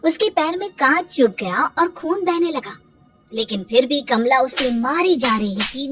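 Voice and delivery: ordinary delivery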